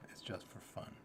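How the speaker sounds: soft whispered aside. Shown as a demonstration. Reassuring listeners with a whispered aside. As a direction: whisper it like a confession. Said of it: "repeats it whispered".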